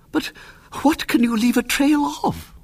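put-on high old lady question; puzzled, fluttery; fussy aunt. A narrator's reading. Puzzled aunt asking William about the trail, high.

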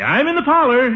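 high-pitched answering call; calling back. Acted. Uncle answers his niece from the parlor, calling back. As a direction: call back to someone in another room.

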